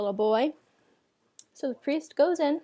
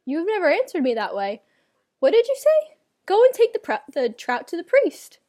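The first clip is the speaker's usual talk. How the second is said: a much higher, louder, startled outcry; a father who cannot believe his ears; surprised father; 6 semitones higher, 6 dB louder than usual. Acted. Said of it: "his father was surprised".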